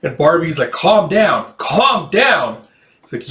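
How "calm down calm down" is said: high, swooping plea for calm; acted, urgent calming of the group; agitated urging. Barbie barks over a quarrel.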